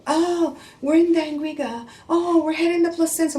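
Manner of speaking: high, breezy carefree voice; mock the brother's carefree cheer; imitating cheerful brother. Wife imitating the brother-in-law on the phone, breezy. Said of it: "the brother would answer the phone and go".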